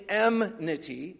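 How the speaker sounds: enmity said as EM-nity, m before n; easier mispronunciation. Shown as a demonstration. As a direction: swap the sounds in enmity to EM-nity. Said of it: "it's because I can say that better".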